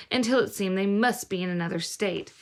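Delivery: ordinary delivery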